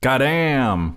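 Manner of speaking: high, drawn-out exclamation; mock amazement. Co-host reacting to Danny DeVito's endorsement tweet, mock amazement. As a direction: react as if hugely impressed.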